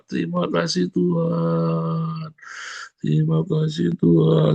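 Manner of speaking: high, slow pleading thanks; tearful thanks. Shown as a demonstration. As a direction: cry out thanks as if weeping. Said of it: "Sambil nangis". (syukur sambil menangis, tinggi)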